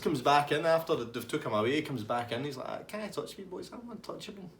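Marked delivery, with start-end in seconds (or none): 2.9-4.5 high, soft mock taunt; cheeky. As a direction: imitate an untouchable chancer, pitched right up. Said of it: "you need to stop being cheeky".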